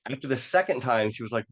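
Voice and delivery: normal talking voice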